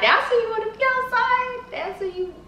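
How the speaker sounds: exaggerated whine. Real, not acted. Exaggerated annoyed complaint.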